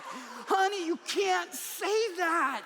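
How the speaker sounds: high, loud, very slow exclamation of alarm; alarmed shout. Retold or imitated. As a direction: cry out in shocked protest at your wife. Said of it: "I was like".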